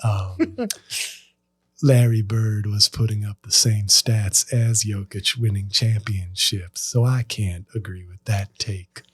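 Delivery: low, measured Isaiah Thomas voice; Isaiah Thomas impression. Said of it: "When I do an impression".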